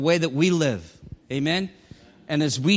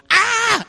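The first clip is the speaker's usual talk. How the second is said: very high, loud shriek of fright; startled scream; 8 dB louder than usual. Retold or imitated. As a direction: mimic a shop clerk screaming in shock. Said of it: "looked at him and goes".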